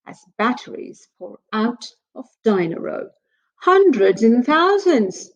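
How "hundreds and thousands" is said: higher, louder, slow cry; excited cry. Acted. Peppa crying out as batteries pour out, excited and loud. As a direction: call it out in delight. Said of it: "cries Peppa".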